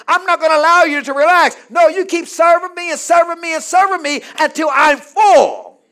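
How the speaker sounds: loud, high preacher voicing a demanding master; shouted, rhythmic sermon build; full-force preaching. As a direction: drive the orders home from the pulpit.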